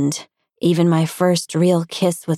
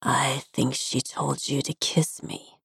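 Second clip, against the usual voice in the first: soft, breathed words against her lips; breathed close; 3 semitones lower, 9 dB quieter than usual. A narrator's reading. Kurt breathes teasing words against Mel's lips. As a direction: breathe it, intimate and near. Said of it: "breathed against my lips".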